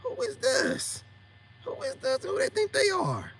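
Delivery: very high-pitched incredulous inner voice; voice in the head. Retold or imitated. God as the voice in your head, high incredulous questioning. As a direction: pitch it way up, like an indignant little voice. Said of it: "the voice in your head saying".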